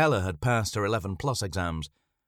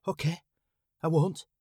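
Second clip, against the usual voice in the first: small, high meek reply; a young girl's timid higher voice; 9 semitones higher than usual. Acted. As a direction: answer meekly like a frightened girl. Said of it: "she replied meekly".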